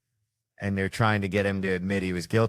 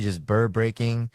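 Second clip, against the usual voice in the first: ordinary delivery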